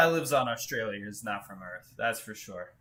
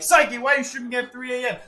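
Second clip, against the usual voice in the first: mock viewer voice; 9 semitones higher, 8 dB louder than usual.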